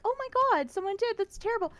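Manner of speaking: high, hammed-up shocked voice; mock shocked gasp. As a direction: pile on fake shock for a friend. Mock shock at friend's news.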